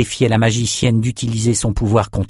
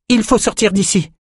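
very high-pitched, alarmed call; urgent call; 11 semitones higher than usual. A narrator's reading. Woman urges the group to flee the collapse, urgent high call. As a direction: urge everyone out, voice pitched right up. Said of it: "lança Callan".

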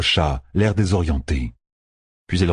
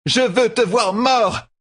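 slow, very high-pitched furious shout; slower than usual. A narrator's reading. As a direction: scream it at your enemy, drawing out each word. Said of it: "cria la traqueuse". (cri de haine, aigu et étiré)

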